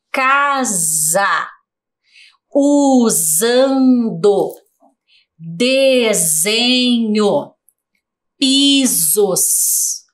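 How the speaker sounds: slow, drawn-out word list, each middle S buzzed as Z; careful classroom sounding-out with long vowels and voiced Z; S sounded as Z. Shown as a demonstration. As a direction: read each word stretched syllable by syllable, leaning on the Z sound. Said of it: "todas elas tiveram som de Z".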